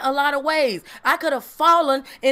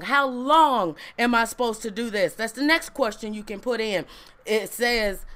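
ordinary delivery

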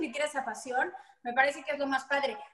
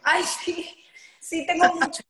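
laughing; 3 semitones higher, 3 dB louder, slower than usual. Real, not acted. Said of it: "tienes algún oso".

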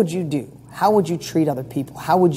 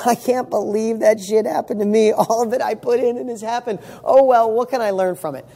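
higher, amused and easy-going; laughing it off; 5 semitones higher than usual. Retold or imitated. Same setback retold, now laughed off with amusement. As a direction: shrug it off with a chuckle and move on.

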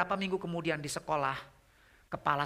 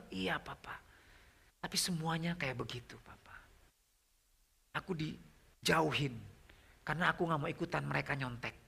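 soft, halting imitation of a sad daughter; understated hurt, trailing away on Papa; retold tearful confession; 5 dB quieter than usual. Daughter tells Papa she is shunned, mildly tearful. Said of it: "dia nangis di mobil".

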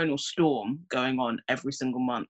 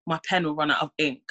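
usual speaking voice

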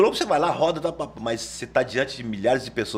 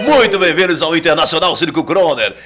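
booming high ringmaster call, much higher and louder; 4 semitones higher, 10 dB louder than usual. Shown as a demonstration. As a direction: announce it to a full circus tent. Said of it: "E aí já é de um lado diferente".

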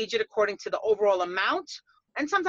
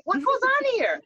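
surprised; 11 semitones higher than usual. Retold or imitated. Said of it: "I'm like".

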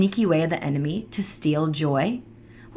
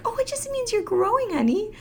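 reassuring mom; 13 semitones higher than usual. Retold or imitated. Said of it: "And I'm like".

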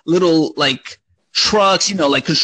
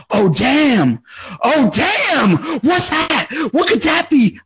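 put-on higher and higher, thrilled kid spotting a present; excited kid; 8 semitones higher, slower than usual. Reliving spotting the big box under the tree, excited. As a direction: gasp out the surprise like a kid on Christmas morning. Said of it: "I was so excited".

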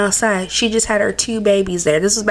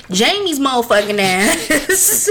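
normal talking voice

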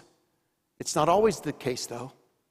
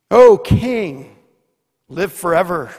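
Daniel calls out; 10 dB louder than usual. Acted. Daniel calls up to the king from the den, loud. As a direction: shout it up to the king. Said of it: "And Daniel says".

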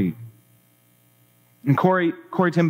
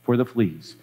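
low, calm and untroubled; serene; 4 semitones lower than usual. Acted. Betsy thanks God in prayer, low and serene. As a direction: say "fleas" serenely, pitched low. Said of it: "Betsy went on serenely".

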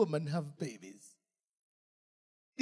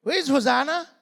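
higher, louder bewildered donkey; confused donkey; 9 semitones higher, 8 dB louder than usual. Pretend. Donkey back in the shed misses the crowd, higher bewildered cry. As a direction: play the lost donkey searching.

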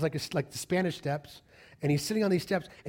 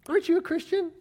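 very high-pitched challenge; bristled retort; faster than usual. Retold or imitated. Offended helper questioning the party guest, high-pitched and bristling. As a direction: squeak it out, offended.

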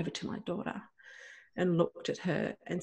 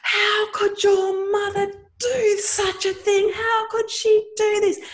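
high, loud, slow outraged shriek; teacher screaming at a five-year-old in front of the class; 8 dB louder, slower than usual. Retold or imitated. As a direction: shriek it in disbelief at a child. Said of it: "she screamed".

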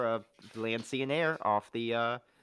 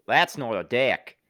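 mock Crocodile Dundee; 7 dB louder, slower than usual. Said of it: "Australian parody line".

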